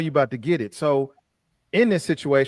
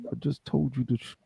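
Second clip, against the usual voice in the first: soft menacing mutter; veiled threat without moving lips; quiet threat through teeth; 2 semitones lower, 8 dB quieter than usual. Shown as a demonstration. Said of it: "was like".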